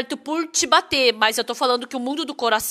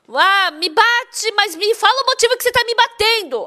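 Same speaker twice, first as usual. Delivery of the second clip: imitated plea; 9 semitones higher, 3 dB louder than usual. Beaten wife demanding a reason from husband, imitated plea. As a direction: plead in a raised, mimicking voice.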